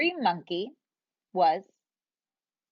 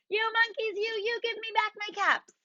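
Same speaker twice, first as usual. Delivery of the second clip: high, strained angry shout; shouted demand; 10 semitones higher than usual. Acted. Furious peddler shouting at copying monkeys, high. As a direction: yell it with full frustration. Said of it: "He shouted".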